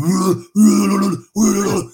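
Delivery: loud repeated high strained growls; mock metal growls. Singing into a cassette player as a kid, loud strained grunts. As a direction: grunt out the bad vocals into the tape. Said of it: "I'm just going".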